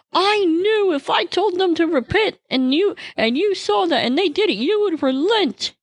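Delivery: a hammed-up furious prophet; furious complaint. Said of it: "And he was furious".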